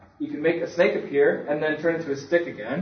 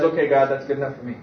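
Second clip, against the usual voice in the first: quick, breezy yes from Moses, in a different timbre; imagined agreeable Moses; 3 semitones lower, faster than usual. Retold or imitated. Moses cheerfully accepting God's offer, hasty and light. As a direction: rattle it off like it's settled. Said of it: "Moses does not say that".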